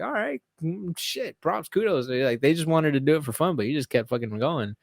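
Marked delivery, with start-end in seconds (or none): 0.0-2.1 impressed. Retold or imitated. Impressed by Merab's running.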